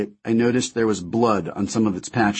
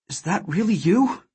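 tearful disbelief; 10 semitones higher than usual. Acted. Mother sees husband returned.

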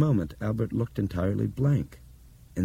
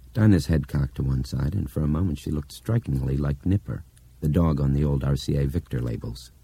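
ordinary delivery, nothing marked

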